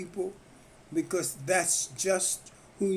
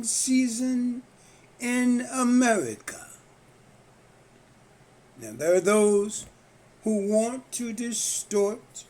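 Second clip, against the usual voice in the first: slow, deliberate oratory with long pauses; monologue recitation; 3 semitones higher than usual. Acted. Reciting a self-written note about the country, solemn monologue recitation. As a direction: deliver it as a written piece, stretching key words and building in pitch.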